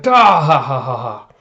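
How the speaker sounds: loud, slow, spelled-out belly laugh; hearty pirate laugh. Retold or imitated. Whitebeard pirates roaring with laughter, loud and slow. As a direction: ham up a crew's big laugh.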